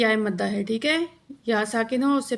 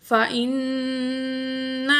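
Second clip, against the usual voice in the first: slow, drawn-out tajweed chant; Quran recitation; much slower than usual. Shown as a demonstration. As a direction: recite slowly with long vowels. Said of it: "حمزہ زیر نون". (آہستہ تجوید کے ساتھ تلاوت)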